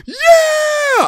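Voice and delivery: high, loud yell; very high loud shout; crowd cheer. Retold or imitated. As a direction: roar like the crowd. Crowd erupts for opponent.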